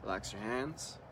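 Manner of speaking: calm meditation leader leaving room to breathe; guided meditation. Real, not acted. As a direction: guide them into relaxing, unhurried, one short instruction at a time. Leads a guided relaxation meditation.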